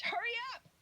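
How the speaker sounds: high, mock-impatient parent call; impatient hurry up. Parent rushing a kid, mock impatient call. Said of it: "one of them is".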